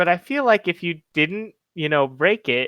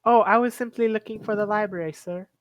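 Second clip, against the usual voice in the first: higher, affectedly polite character voice; trying to sound smart; 5 semitones higher than usual. Acted. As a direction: put on airs for a polite reply. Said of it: "What's wrong with your voice?".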